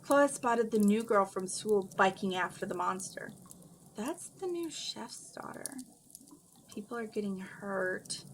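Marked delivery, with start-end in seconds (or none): 3.9-8.2 mumbling. A narrator's reading. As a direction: mumble it to yourself. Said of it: "she mumbled".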